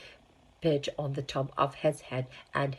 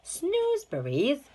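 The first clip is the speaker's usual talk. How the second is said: surprised question; 12 semitones higher, 4 dB louder than usual. Acted. Child interrupts Wonka surprised.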